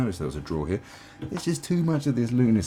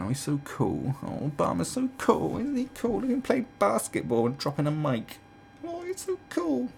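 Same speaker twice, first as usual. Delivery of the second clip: high-pitched swooning fan parody; hammed-up adoring squeal; mock gushing; 11 semitones higher than usual. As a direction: gush like a star-struck fan, sending it up. Mocking Obama's gushing fans.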